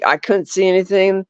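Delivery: ordinary talk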